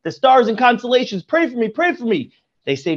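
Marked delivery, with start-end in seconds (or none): 1.2-2.3 higher-pitched imploring repeat; a hint of frantic begging, raised pitch; desperate plea. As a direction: plead over and over, frantic. Begging the stars.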